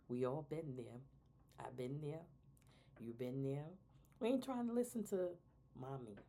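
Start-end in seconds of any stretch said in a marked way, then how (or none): 4.2-6.2 mock teen attitude.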